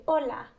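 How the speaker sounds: "hola" as OH-lah with no H; silent H. Shown as a demonstration. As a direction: start "hola" directly on the vowel O. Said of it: "So the H is silent".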